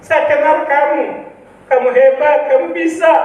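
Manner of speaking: high-pitched retold pep talk from a cross wife; wife's scolding encouragement. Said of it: "istri saya marah-marah".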